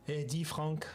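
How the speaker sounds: French-accented greeting. Shown as a demonstration. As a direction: call out Franck like a French colleague. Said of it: "Oh no, that would be awful".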